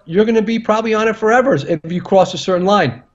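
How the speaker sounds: normal talking voice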